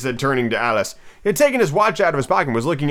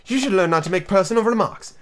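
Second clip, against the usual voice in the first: severe; 6 semitones higher than usual. A narrator's reading. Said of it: "said Alice with some severity".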